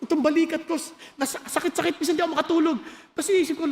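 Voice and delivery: ordinary delivery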